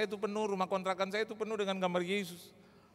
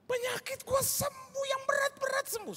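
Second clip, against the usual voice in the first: amazed exclaiming. Retold or imitated. Healed friend exclaiming to the pastor, amazed and high. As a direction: marvel that the illness is gone.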